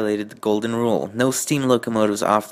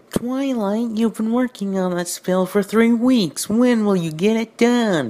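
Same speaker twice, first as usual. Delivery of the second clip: high, louder worried squeaky voice; concerned assistant; 11 semitones higher, 4 dB louder, slower than usual. A narrator's reading. Assistant worrying at Twilight about the spell, in a high squeak. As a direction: fret over her unfinished spell. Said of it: "Twilight's number one assistant asked in concern".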